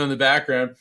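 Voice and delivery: ordinary talk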